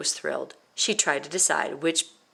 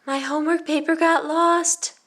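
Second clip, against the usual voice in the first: much higher small voice; tiny voice; 11 semitones higher, 5 dB louder than usual. Pretend. Violet imagining confessing to the teacher, tiny high voice. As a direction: squeak it out like a tiny child. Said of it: "in a tiny voice".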